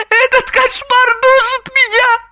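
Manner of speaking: very high, tearful whine; high-pitched snivelling distress; sniffling. A narrator's reading. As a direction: whimper as if choking on tears. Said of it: "хлюпая носом, сообщила Самохина".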